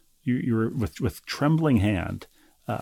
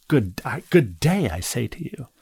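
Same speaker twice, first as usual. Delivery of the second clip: a lightly voiced, curt brush-off with a punched, louder "good day"; dismissive Willy Wonka; 3 dB louder than usual. Host mimics a hostile Willy Wonka-style dismissal. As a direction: slam the door on them with "good day, I say". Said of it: "that's kind of dismissively, uh, Willy Wonka".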